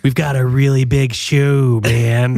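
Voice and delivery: old TV host impression; Ed Sullivan. Showing off an impression to the co-host, showman-like. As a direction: announce it like a variety-show host. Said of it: "That's my Ed Sullivan".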